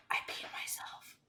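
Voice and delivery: whispered confession. Retold or imitated. Client whispering to her waxer that she peed. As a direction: breathe it out with no voice. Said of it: "but you whispering".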